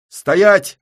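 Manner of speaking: high, loud commanding yell. Acted. Syava yells at everyone to freeze, loud and high. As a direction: bark the order to stop. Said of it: "орёт Сява".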